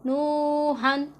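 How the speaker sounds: very slow, drawn-out recitation of नूहन with a clear नून; Quranic recitation. Shown as a demonstration. Teacher reciting the tanween example slowly for her students. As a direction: stretch नूहन out, sounding the नून plainly. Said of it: "इसी तरह पढ़ना है".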